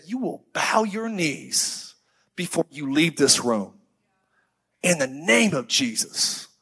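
slow, solemn commanding rebuke. Retold or imitated. Branham orders the giant to bow, slow solemn command. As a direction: drag each word out like a command.

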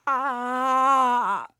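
high, sustained scream of terror; terrible scream. A narrator's reading. Terrified boy flees the hooded figure, screaming. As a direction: let out one long high scream. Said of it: "let out a terrible scream".

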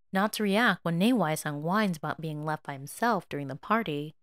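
ordinary delivery, nothing marked

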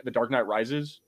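ordinary delivery

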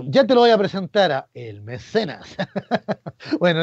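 ordinary talk, nothing marked

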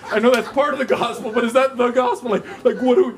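high, loud, laughing bewilderment; laughing confusion. Retold or imitated. Two students walking away from the woman, laughing loudly. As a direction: laugh at your own failure. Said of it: "We're like".

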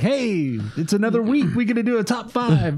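high, eager, bouncy voice; excited. Pretend. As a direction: voice a thrilled countdown. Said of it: "not nearly as excited as number five is".

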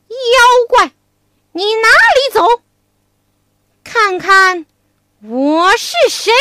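Wukong shouts at the fleeing demon; loud challenge. Acted. 悟空揪住妖怪大声喝问，高声喊叫. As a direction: bellow it out, high and loud. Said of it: "大声叫道".

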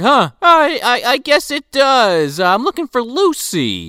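high, dazed, slow rambling; confused Santa. Retold or imitated. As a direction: sound lost and dreamy. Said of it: "he talks crazy immediately".

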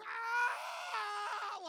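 long, high imitated scream; loud wail of a terrified kid. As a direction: scream all the way down the drop. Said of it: "He's screaming".

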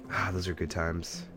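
ordinary talk

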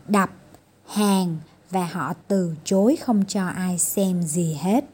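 ordinary delivery, nothing marked

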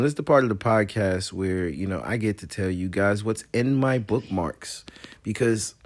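usual speaking voice

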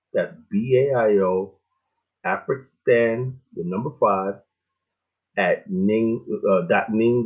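slow and clear so listeners can write it down; slow dictation. Shown as a demonstration. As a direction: spell each letter out slowly. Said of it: "repeating the address for listeners".

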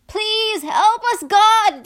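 loud, high desperate plea; frightened shouted prayer; praying aloud for help. A narrator's reading. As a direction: cry out to God in a storm. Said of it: "they prayed aloud".

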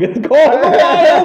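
bursting into laughter mid-sentence; laughing. Real, not acted. Host cracking up while reading a ridiculous nominee, laughing loudly. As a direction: laugh the title out, slow and loud.

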